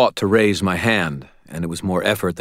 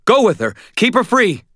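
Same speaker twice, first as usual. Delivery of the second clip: high, loud urgent commands; shouted orders; 5 dB louder than usual. A narrator's reading.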